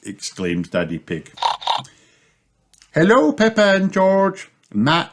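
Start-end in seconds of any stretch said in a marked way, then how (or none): 2.9-5.1 high-pitched cat lady voice; high, greeting cat character; Missus Cat. A narrator's reading. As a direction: greet the children as a cartoon cat.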